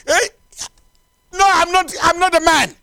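high, loud cry of mistaken identity; acted panicked protest. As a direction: plead urgently as the hunted man. Acts the hunted king protesting.

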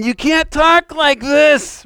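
high, drawn-out protest; mock objection. Everything in your makeup saying it, high protest. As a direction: mimic an objecting inner voice, slowly. Voices inner objection to such talk.

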